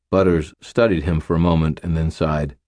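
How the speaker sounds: plain reading voice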